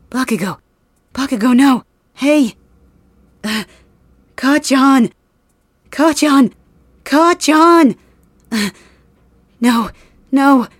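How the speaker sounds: high, loud cries of grief; anguished screaming. Acted. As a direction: scream his name as he dies. Screams as friend dies.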